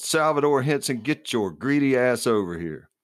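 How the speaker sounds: high, accusing call across the crowd; yelling. A narrator's reading. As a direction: call him out in front of everyone. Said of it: "Bill's wife yelled".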